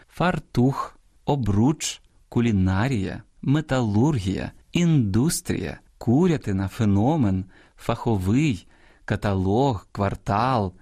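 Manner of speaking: each word said separately with its stressed syllable leaned on; correct stress demonstration. Shown as a demonstration. Host drawing listeners' attention to word stress, careful stress demonstration. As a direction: model the dictionary stress, word by word. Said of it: "Зверніть увагу на наголошення".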